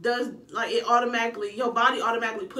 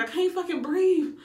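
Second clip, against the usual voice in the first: high, strained complaint; fed-up cry; 7 semitones higher, 3 dB louder than usual. Retold or imitated. Mother in labour tells the room she can't breathe, strained high cry. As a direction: gasp it out, overheated. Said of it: "I'm like".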